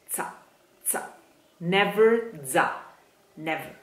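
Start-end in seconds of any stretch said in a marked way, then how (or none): none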